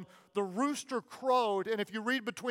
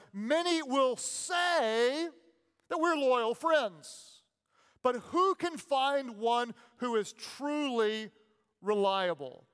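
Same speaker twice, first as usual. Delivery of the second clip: solemn scripture reading; 3 semitones higher, slower than usual. Shown as a demonstration.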